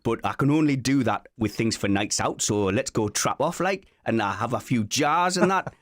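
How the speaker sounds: teasing Geordie impression; Newcastle accent. Shown as a demonstration. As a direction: put on a lilting Newcastle accent. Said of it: "exactly what you sound like".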